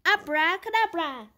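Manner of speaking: loud, ringing magic word; shouted spell. A narrator's reading. Witch Winnie shouting her spell at the broomstick. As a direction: cast the spell with a big shout. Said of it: "and shouted".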